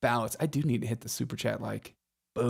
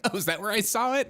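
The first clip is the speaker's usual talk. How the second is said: mom voice; 8 semitones higher than usual. Retold or imitated. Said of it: "She's like".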